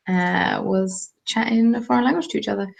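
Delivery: ordinary talk, nothing marked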